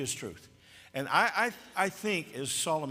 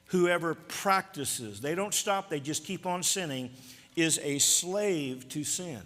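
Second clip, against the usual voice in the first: preaching at full force; 4 semitones higher, 4 dB louder than usual. Acted. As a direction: drive the warning home from the pulpit.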